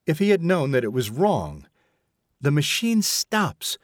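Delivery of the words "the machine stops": higher, slow, mocking echo; venomous. A narrator's reading. Mother recalls her son's remark, venomously. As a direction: spit the son's words back with spite. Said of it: "the venomous sort of remark".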